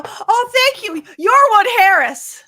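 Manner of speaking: high, loud starstruck gush, slowing on the name. Retold or imitated. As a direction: gush at the actor like a superfan. Starstruck meeting actor on balcony.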